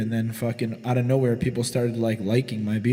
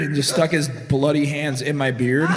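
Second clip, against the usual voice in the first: ordinary delivery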